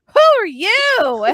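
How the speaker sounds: very high, loud, drawn-out mock yell; imitated rude challenge. Imitating Ant turning to a random blonde girl, mock yell. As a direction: stretch it into a hammed-up holler.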